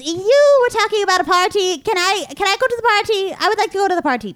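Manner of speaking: high-pitched, insistent begging; eager pleading. Acted. Goblin begs a stranger for a party invitation, eager pleading.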